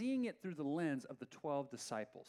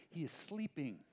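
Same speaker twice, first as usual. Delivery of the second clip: normal talking voice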